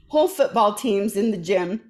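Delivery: ordinary talk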